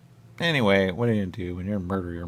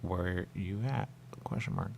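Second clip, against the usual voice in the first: softer, slow reading aloud; flat quiet read; 9 dB quieter than usual. Shown as a demonstration. Player reads the on-screen words, quiet and slow. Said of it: "And I see it at me".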